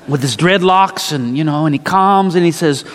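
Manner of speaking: ordinary talk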